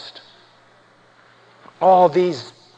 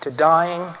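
low, hushed and weighty; subdued, low pulpit solemnity; solemn preaching; 3 semitones lower, 4 dB quieter than usual. Real, not acted. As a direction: preach gravely in a soft, deep register with long pauses.

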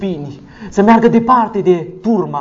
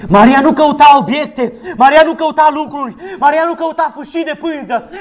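raised, fast, fervent preaching with laughs; preaching at full force; 9 semitones higher than usual. Acted. Pastor rousing the congregation on complaining, fervent preaching. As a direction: hammer each "să se plângă" at the congregation.

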